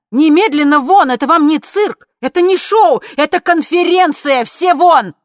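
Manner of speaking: very high, full-force furious shouting; shrieked, outraged orders; screaming. A narrator's reading. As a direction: scream them out of the room. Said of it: "закричала Лина".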